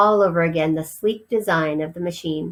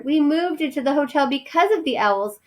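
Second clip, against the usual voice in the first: mother mouse pleading; 8 semitones higher than usual. Acted.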